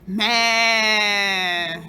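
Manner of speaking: loud drawn-out bleating meh; imitated cow sound. Shown as a demonstration. Showing classmates a cow's call, loud and long. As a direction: belt out a long animal call. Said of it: "the sound of the mbuzi is".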